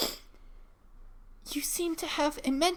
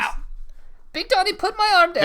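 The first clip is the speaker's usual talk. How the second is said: high loud strained plea; pained plea; 6 semitones higher, 5 dB louder than usual. Acted. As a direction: beg through the pain. Arm being twisted.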